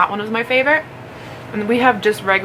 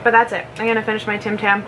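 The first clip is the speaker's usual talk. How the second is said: usual speaking voice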